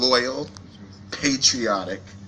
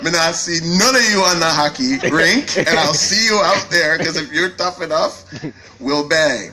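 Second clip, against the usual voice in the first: higher, louder put-on voice, daring the crowd onto the rink; mock tough hockey player; 6 semitones higher, 6 dB louder than usual. CEO jokingly dares guests onto the hockey rink, mock tough and loud. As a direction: ham up a tough hockey challenge.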